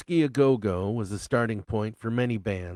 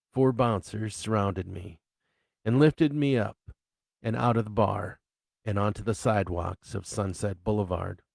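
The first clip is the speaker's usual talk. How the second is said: ordinary delivery, nothing marked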